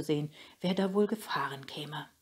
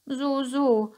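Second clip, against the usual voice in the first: high, loud, drawn so so; sly fox; 9 semitones higher, 7 dB louder than usual. A narrator's reading. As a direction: muse like a crafty fox. The fox voiced.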